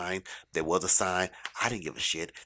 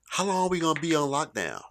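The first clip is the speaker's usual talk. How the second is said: higher-pitched imitation of a child; daughter's question; 7 semitones higher than usual. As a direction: ask it as the young daughter, pitched up. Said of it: "my daughter asked me".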